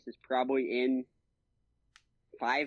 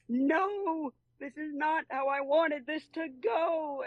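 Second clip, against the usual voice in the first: much higher, slower whiny protest; upset Riddler; 14 semitones higher, slower than usual. Retold or imitated. Riddler realising Batman doesn't care, hammed-up whine. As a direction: whine like a thwarted villain. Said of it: "He's like".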